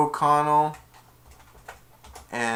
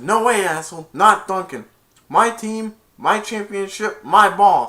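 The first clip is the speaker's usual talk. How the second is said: loud, high territorial refusal; defiant yell; 7 semitones higher, 9 dB louder than usual. Retold or imitated. Player refusing to give up the ball, defiant yell. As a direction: claim it forcefully, punching each phrase. Retells the refusal a second time.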